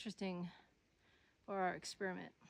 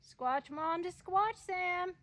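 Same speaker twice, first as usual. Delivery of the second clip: high, raised radio call; 8 semitones higher, 5 dB louder, much slower than usual. Real, not acted. Mom trying Sam on the walkie-talkie, calling out. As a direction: call up to a distant listener, pitch climbing.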